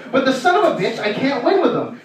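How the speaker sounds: usual speaking voice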